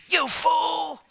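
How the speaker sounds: high, furious yell; Satan yelling at his failed demon; angry shout. Acted. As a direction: snap at him in rage.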